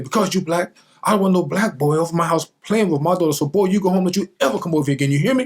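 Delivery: imitating an angry mother shooing a boy away; hostile neighbor mother. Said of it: "She said".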